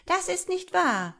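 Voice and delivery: very high, loud outcry; piercing, indignant denial; squabbling shout. A narrator's reading. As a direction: protest at the top of a tiny voice. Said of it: "riefen sie alle durcheinander".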